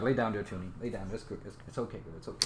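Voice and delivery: low, soft reassurance to a pet; soothing. Real, not acted. Owner calming the dog he scared, soft and soothing.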